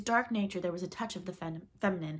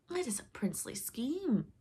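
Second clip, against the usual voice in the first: higher-pitched delighted cry; exclaiming; 6 semitones higher than usual. A narrator's reading. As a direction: seize on the scheme as Hook. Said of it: "cried Hook".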